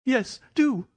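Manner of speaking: high-pitched, frightened plea; timid little man imploring; pleading. Acted. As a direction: beg in a high, helpless voice. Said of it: "pleaded Thaddeus Sholto".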